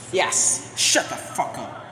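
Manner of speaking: ordinary talk, nothing marked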